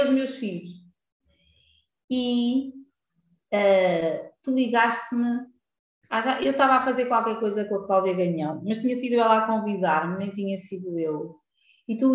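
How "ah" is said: usual speaking voice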